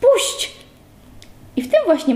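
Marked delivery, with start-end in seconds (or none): 0.0-0.6 very high, choked cry to let go; desperate shriek. A narrator's reading. Pyza shrieking at Bernard to let her go, panicked high cry. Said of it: "zduszony wrzask".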